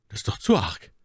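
leicht empört, höher. A narrator's reading. Voiced Milady. (Mylady empört sich über das Schweigen)

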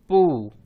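पू as p plus long oo; blended syllable demo. Shown as a demonstration. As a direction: model पू for learners. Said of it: "तो बन गया".

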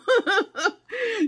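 high, loud burst of laughter; tickled, high-pitched laugh; delighted laugh. Real, not acted. Delighted by the purple glasses, high laugh.

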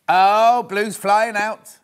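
loud, slow delighted exclamation; excited. Real, not acted. Host thrilled at sales to viewers, loud and excited. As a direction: announce it with real glee.

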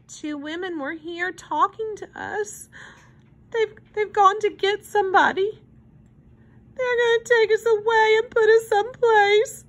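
high, plaintive little voice; tearful child. A narrator's reading. Upset child tells hobo they'll be taken away, tearfully. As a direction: tell it haltingly, close to tears. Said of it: "wiping his eyes".

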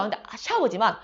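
normal talking voice

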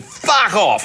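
high-pitched mock yell of dismissal; imitating a boozy, irate actor; drunken bellow. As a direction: yell it like a drunk through an intercom. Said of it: "He'd been drinking since about nine".

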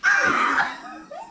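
high breathy cackle of a laugh; helpless high laugh. Real, not acted. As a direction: explode into laughter. Bursts out laughing.

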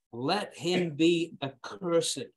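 slow, deliberate "let him be accursed"; slow, grave pronouncement; solemn scripture close. Acted. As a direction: drop each word like a verdict. Solemn verse climax.

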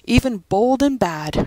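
usual speaking voice

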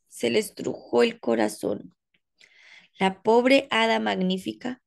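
ordinary delivery, nothing marked

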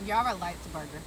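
talking while chewing food; covering mouth while eating; mouth full, chewing. Real, not acted. Seen 0: Holds bitten burger; hand covers chewing mouth from 1.1s onward.

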